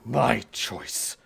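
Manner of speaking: loud, menacing declaration; forceful outburst. Acted. Man reaching towards his taunter, loud and forceful. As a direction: throw the words out hard and loud. Attacker reaches out, snaps back.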